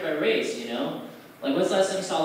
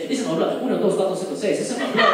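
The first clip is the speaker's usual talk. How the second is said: proud homie claim, louder; mock Mexican accent; 2 semitones higher, 4 dB louder, faster than usual. Comic claiming Mexican blood, mock accent. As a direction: declare it with chest out. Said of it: "I say".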